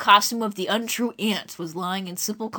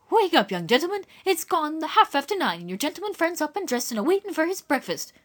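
housekeeper calling; 8 semitones higher than usual. Acted. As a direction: call them awake like an old housekeeper. Missus Beale's voice.

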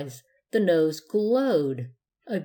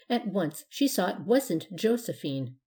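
ordinary delivery

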